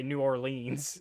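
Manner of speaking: New Orleans ending in a long "LEENZ"; wrong pronunciation. Shown as a demonstration. Telling how you really get it wrong, mispronounced. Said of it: "how you really get it wrong".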